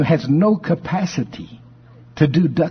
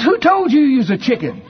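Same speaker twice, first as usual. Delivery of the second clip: amused duck; 9 semitones higher than usual. Acted. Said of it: "And he goes".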